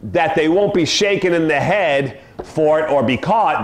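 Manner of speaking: ordinary delivery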